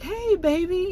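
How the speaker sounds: high-pitched mock-sweet hello to a partner; happy greeting. Derek greets Keyshawn coming home, happy to see her. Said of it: "He's so happy to see her".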